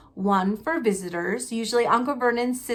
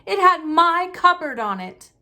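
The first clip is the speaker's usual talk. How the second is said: higher, louder indignant point; angry; 7 semitones higher, 6 dB louder than usual. Acted. Harry angrily arguing with his uncle, higher and louder. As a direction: press the proof at him. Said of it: "said Harry angrily".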